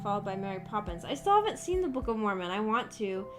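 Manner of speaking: ordinary talk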